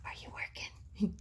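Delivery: voiceless, very soft whispered question; imitated whisper. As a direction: mimic someone's hushed whisper. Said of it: "He's whispering".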